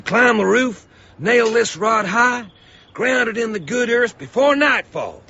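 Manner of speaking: urgent commands. Acted. Lightning rod salesman urging boys.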